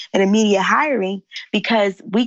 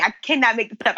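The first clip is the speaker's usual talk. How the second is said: normal talking voice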